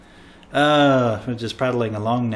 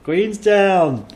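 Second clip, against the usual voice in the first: called out answer; 8 semitones higher, 7 dB louder than usual. Retold or imitated. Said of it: "It's like".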